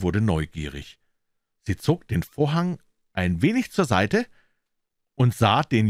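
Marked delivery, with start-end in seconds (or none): none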